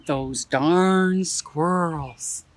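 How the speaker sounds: slow, weighty title read; deliberate storyteller title announcement; drawn-out title. Shown as a demonstration. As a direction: stretch out each word, lean on darn. Reading the title dramatically.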